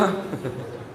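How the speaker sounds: soft unvoiced chuckle at his own joke; breathless silent laugh. Real, not acted. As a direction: laugh almost without voice. (بے آواز دبی ہنسی)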